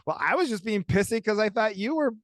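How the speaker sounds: high, whiny self-justification, acted out; mock defensive arguing. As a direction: imitate a spouse shifting the blame. Acted argument over who started it.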